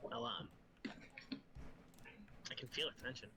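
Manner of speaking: awkward, low and soft. Acted. Glacia voiced awkwardly.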